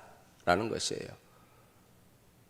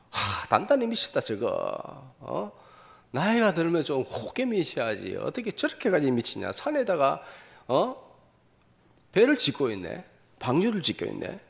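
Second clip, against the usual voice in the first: scoffing onlookers, imitated at a raised pitch; mocking ridicule; 3 semitones higher than usual. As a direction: jeer at a madman, acting it out. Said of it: "조롱하고 비난하고".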